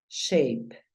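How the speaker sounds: shape with the English ei sound, sheip; English pronunciation. Shown as a demonstration. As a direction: tell the student shape, English style. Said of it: "Entonces tú dices".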